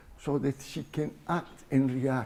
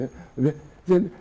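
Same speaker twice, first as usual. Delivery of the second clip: ordinary delivery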